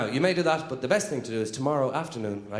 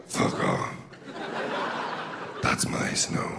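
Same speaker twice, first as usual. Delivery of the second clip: low, voiceless growl from behind glass; muffled, toneless grumble; man pressed against glass; much slower than usual. Acted. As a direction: squash the words out as if face-flattened on a windscreen. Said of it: "inside there's a man with his face pressed against the glass".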